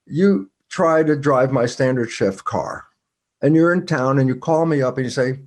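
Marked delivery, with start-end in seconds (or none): none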